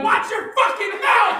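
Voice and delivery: very high-pitched mother's shout; scolding mom. Retold or imitated. Mother to her swearing son, high scolding shout. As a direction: shriek the reprimand at your kid.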